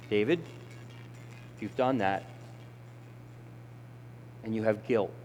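soft, low Holy Spirit voice; gentle inner voice. Acted. Holy Spirit gently speaking to David, soft and low.

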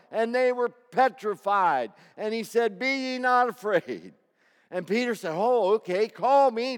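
loud, high fervent preaching; rising full-voiced sermon; preaching at full force. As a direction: drive it home to the congregation.

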